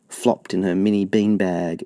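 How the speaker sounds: ordinary delivery, nothing marked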